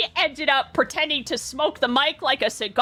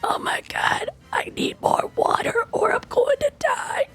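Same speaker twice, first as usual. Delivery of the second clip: voiceless rasp, pitched up at the end; lost voice whisper; 8 semitones higher than usual. Retold or imitated. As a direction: gasp it out with no voice. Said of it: "she would be like".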